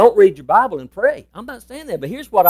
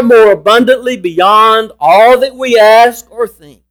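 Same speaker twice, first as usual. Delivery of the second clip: slow, raised, ringing scripture reading; proclaimed scripture reading; 5 semitones higher, 4 dB louder, slower than usual. Real, not acted. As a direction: stretch the key words and lift them high. Reads scripture aloud with force.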